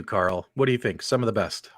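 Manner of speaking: ordinary talk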